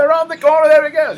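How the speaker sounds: very high, loud Australian announcer call; hammed-up Aussie track commentary; Aussie race caller. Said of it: "my Aussie accent got in there".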